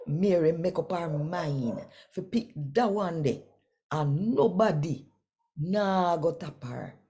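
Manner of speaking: animated Bible story narration; scripture reading. Acted. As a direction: tell the Bible story to the listeners. Reads scripture story aloud.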